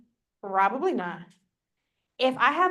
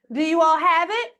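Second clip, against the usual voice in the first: calling out; 6 semitones higher, 6 dB louder than usual. Real, not acted. Coach calling out to silent room.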